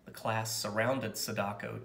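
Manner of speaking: usual speaking voice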